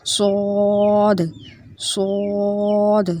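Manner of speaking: صاد slow and thick, lips rounded, mouth full of air; rounded heavy صاد; heavy letter. Shown as a demonstration. Teacher stretching out a heavy صاد for students. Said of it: "ہونٹ جو ہیں وہ گول ہوتے ہیں".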